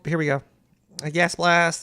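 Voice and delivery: ordinary delivery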